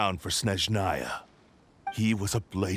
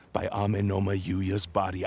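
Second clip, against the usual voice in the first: ordinary delivery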